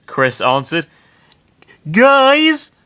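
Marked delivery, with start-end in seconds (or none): 1.9-2.7 much higher, louder alarmed call; scared Sonic. Acted. Sonic panicking at the mob, much higher.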